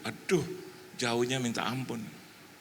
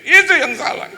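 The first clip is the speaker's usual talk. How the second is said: high, loud pointed blame; retold outburst of blame; shouted accusation; 13 dB louder than usual. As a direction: yell the verdict indignantly.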